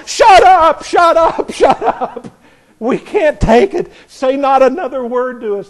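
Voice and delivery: high, loud mock yell with a laugh; frantic shouting. Preacher voicing Israel begging God to stop, mock frantic shout. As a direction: shriek it like a terrified crowd. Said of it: "And they go".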